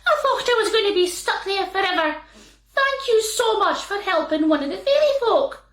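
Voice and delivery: fairy man thanking Sandy, high and quick. Acted. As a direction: chatter out thanks like a wee fairy. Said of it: "said the wee man".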